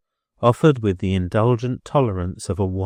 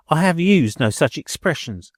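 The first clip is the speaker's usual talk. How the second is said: indignant landlady; 8 semitones higher, 4 dB louder than usual. A narrator's reading. Billiken cuts her off.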